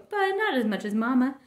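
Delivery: plain reading voice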